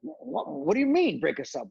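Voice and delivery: high-pitched disbelief, retold; incredulous protest. Tag partners to Vince on being split up, incredulous. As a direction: imitate yourself protesting in disbelief.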